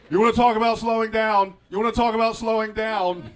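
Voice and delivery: high, very loud blurted question; loud intrusive outburst. Acted. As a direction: burst in shouting, insistently demanding attention. Blurting out the distracting thought.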